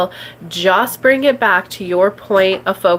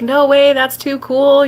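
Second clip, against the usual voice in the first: mock-excited friend; 5 semitones higher than usual. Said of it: "She's like".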